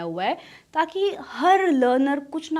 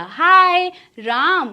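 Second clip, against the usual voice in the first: calling out; 6 semitones higher, 8 dB louder, much slower than usual. Pretend. Calling out to someone.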